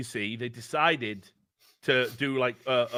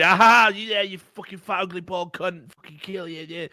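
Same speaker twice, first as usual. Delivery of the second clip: mock troll taunt; 6 semitones higher, 5 dB louder than usual. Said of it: "and say".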